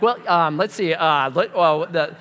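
flustered, stumbling search for words; loud, scrambling hesitation; unprepared. Retold or imitated. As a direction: act out fumbling with fillers and false starts. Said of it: "I wasn't actually prepared for that".

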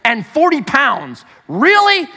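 a touch of retold astonishment at a four-year-old; dad stunned by his daughter's defiance; shocked dad. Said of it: "I was so shocked by this".

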